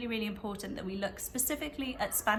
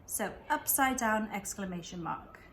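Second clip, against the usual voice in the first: ordinary delivery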